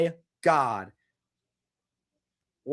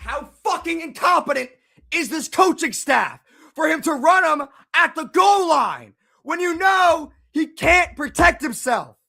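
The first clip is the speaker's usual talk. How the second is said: high, loud furious yelling; angry rant; 8 semitones higher, 4 dB louder than usual. Real, not acted. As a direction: tear into the coaches at full volume. Fan ranting at coaching staff.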